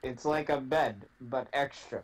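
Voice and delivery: usual speaking voice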